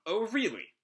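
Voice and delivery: high, swooping mock interest; mock him with a high-pitched lilt. Shora taunting Shiki, mocking. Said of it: "Shora kinda mocks him".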